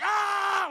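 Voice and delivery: loud high scream; hammed-up shrieking yell; butt yell. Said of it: "My butt was just like".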